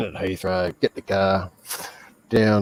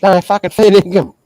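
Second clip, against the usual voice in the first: high, loud protest of honesty; worked-up insistence over laughter; emphatic insistence; 8 semitones higher, 9 dB louder than usual. Real, not acted. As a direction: swear it's true, loud and pitched up. Insisting after listener laughs.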